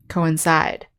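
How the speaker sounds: "coincide" with stressed long I in CIDE; co-in-CIDE, land on the last syllable of "coincide". Shown as a demonstration. Teacher repeats the verb, stressing the long I ending of "coincide". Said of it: "the stress is on the last syllable".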